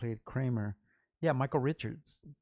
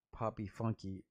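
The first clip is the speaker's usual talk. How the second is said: normal talking voice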